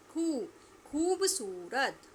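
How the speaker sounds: खूबसूरत said very slowly with a long, loud खू; drawn-out model word. Shown as a demonstration. Teacher models a word for pupils to repeat, slow and drawn out. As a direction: stretch खूबसूरत syllable by syllable. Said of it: "आप सभी को मेरे पीछे पीछे दोहराना है".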